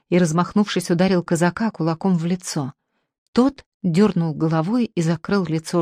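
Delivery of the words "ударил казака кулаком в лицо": ordinary delivery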